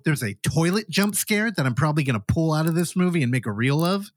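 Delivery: normal talking voice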